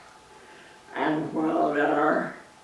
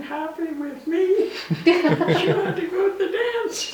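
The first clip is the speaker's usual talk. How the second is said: laughing; 14 semitones higher, 5 dB louder than usual. Real, not acted. Laughing about sister missing dance.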